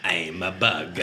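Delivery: soft, whispery bug voice; hint of a creepy insect; flittery bug voice. Joins the D'Vorah bug impression.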